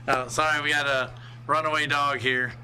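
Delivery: ordinary talk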